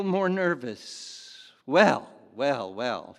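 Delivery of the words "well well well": a loud flustered start that falls away; stuttering. Acted. Nervous manager to the missionary, stuttering, flustered. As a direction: fumble for words, caught off guard. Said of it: "he stuttered".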